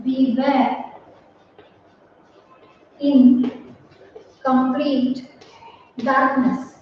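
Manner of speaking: slow, word by word, like dictating to a class; dictation pace. Shown as a demonstration. Teacher dictating an example sentence, slow. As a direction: spell it out slowly for the students to copy.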